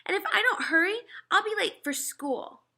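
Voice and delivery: put-on older brother refusing his mother, firmly; boy refusing. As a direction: put your foot down like a fed-up boy. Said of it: "I added".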